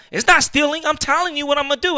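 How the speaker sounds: high, insistent mimicked protest; indignant retort. Retells customer's indignant retort.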